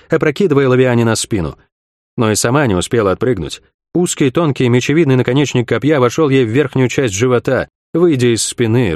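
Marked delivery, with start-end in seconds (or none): none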